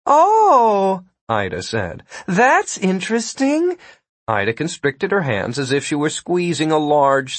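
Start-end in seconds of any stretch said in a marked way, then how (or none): 0.0-1.0 high, louder, drawn-out taunt; taunting rival. A narrator's reading. Ida toys with her rival. 2.3-3.8 high, slow, toying voice; taunting rival. A narrator's reading. Ida toys with her rival.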